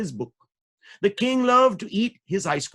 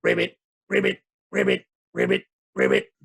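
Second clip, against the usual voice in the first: deep croaking ribbit; deep frog croaks for the kids. Acted. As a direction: croak like a frog, much lower. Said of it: "the frogs would go".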